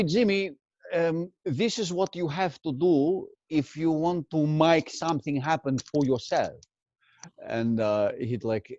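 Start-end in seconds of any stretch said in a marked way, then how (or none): 0.0-6.5 imitating his Greek Australian father's accent; a dad's heavy Greek Australian lilt. Doing his dad's Greek Australian accent for his mother. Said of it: "a Greek Australian feta cheese accent".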